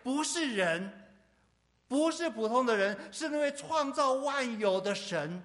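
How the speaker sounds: loud, high-pitched sermon delivery; impassioned preaching. Real, not acted. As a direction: preach at full force, driving each phrase home. Preaching at full force.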